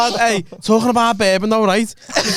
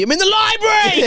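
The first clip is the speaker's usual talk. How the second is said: Trigger Happy TV shout. Retold or imitated. Mate quoting the Trigger Happy TV phone sketch, high mock yell. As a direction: bellow it like the prank phone guy. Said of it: "Sounds like Trigger Happy T V".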